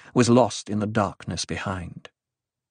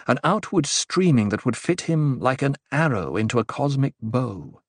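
ordinary delivery

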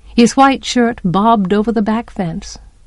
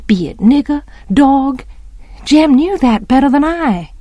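ordinary delivery

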